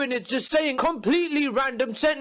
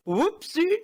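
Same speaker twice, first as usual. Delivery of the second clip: higher, giggly doting voice; doting woman; 5 semitones higher than usual. Retold or imitated. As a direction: laugh it off like a cooing mum. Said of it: "the reaction's always something like".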